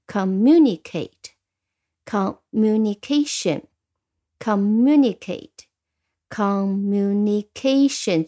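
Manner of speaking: slow, spaced-out words for repeating; read-along model. Shown as a demonstration. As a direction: draw out each syllable so learners can follow. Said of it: "所以你可以跟着我读两遍".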